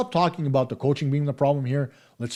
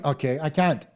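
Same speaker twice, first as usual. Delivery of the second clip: ordinary talk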